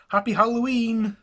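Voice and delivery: high, loud and bright; cheerful holiday wish. Acted. Jackson wishing his secretary a happy holiday, joyful. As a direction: call it out with joy.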